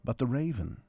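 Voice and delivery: usual speaking voice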